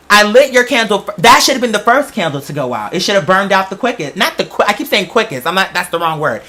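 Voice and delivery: ordinary delivery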